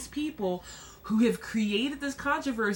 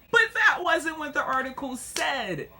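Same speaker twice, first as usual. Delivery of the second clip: ordinary talk, nothing marked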